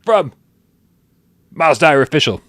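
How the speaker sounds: high, fast announcer intro; host intro. Acted. Host introducing himself and Miles, announcer style. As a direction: introduce yourself like a showman.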